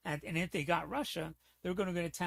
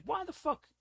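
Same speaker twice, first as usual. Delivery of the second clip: exasperated outburst; 14 semitones higher than usual. Real, not acted. Exasperated rant about unused mercenaries.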